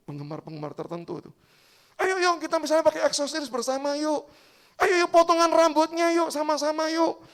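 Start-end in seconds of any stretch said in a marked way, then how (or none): 2.0-7.2 higher, louder coaxing friends; rally the group to match; eager urging. Acted. Friend urging the group to dress alike, eager.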